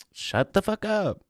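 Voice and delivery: high, slow, put-on voice telling chat off; mock-furious high voice; mock outburst. Streamer mock-yelling at chat to shut up, high put-on voice.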